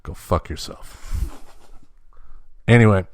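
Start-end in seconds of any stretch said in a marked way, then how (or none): 0.0-1.3 soft, slow, lower whispered curse; joking whisper. Real, not acted. Host jokingly cursing at the boy, whispered. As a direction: toss it off under your breath.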